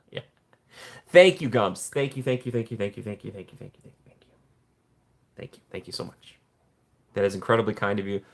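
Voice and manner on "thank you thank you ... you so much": repeated thanks that fade down to a whisper; thanks dwindling to a whispered hush; fading thanks. Real, not acted. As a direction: let each thank you drop softer until it is only breath.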